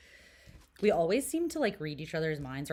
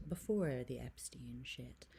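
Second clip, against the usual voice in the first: whisper; 5 semitones lower, 6 dB quieter than usual. Real, not acted. Gossip aside, whispered.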